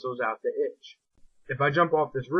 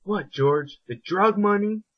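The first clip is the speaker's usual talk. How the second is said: mock shocked mother, higher on drug money; mother confronting her son, shocked; shocked mom; 7 semitones higher, 3 dB louder, slower than usual. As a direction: play the mother catching him out. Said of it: "She's like".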